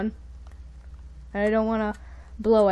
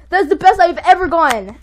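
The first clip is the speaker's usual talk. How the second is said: loud, raised, amazed delight; thrilled exclamation; 5 semitones higher, 8 dB louder than usual. Real, not acted. Player celebrating best placing ever, thrilled exclamation. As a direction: exclaim it, still elated.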